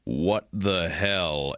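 low, slow, drawn-out disbelief; mock stunned bench reaction; stunned "what the hell". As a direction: let it hang, baffled and slow. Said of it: "shows Dylan Harper like".

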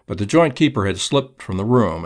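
usual speaking voice

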